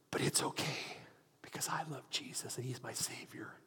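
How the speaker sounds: whispered, barely any voice; faint dying whisper. Retold or imitated. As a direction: breathe it out like a dying man. Dying man's last words.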